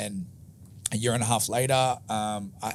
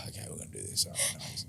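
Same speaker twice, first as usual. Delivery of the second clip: low, hushed aside to yourself; retold quiet resignation; resigned self-talk; 12 semitones lower, 10 dB quieter, faster than usual. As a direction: psych yourself up under your breath. Sighing self-talk before tough client.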